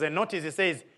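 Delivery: ordinary delivery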